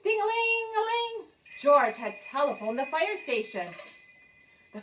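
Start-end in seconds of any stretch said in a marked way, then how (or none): 0.0-1.3 high ringing telephone imitation; phone ring. George's call rings at the fire station, imitated ring. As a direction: sing out the ringing bell high.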